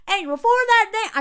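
high, loud imitation Southern accent; wavering Southern accent. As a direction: slip into a mock Southern accent. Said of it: "I keep changing accents".

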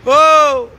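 loud high surprised oh. Real, not acted. Host startled on the live stream, a loud high oh. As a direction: blurt out a loud oh.

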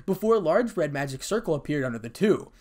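ordinary delivery, nothing marked

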